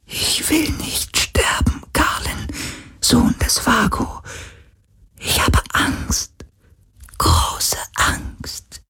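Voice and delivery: trembling whisper of a dying spirit; frightened ghostly whisper. Acted. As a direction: whisper it in dread, near tears. Geist hat Todesangst. (ängstliches Geisterflüstern)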